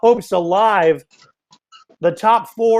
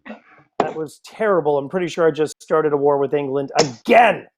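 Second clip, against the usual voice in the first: usual speaking voice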